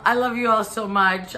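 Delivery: usual speaking voice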